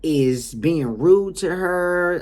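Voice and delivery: putting on a wronged client; imitated client complaint. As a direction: stretch out a mock-innocent grievance. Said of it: "this is how she's saying".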